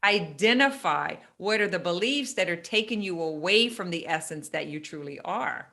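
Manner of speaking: usual speaking voice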